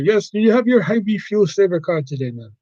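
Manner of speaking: lilting put-on Indian accent. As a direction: ask like a polite Indian-accented cashier. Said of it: "generic Indian character".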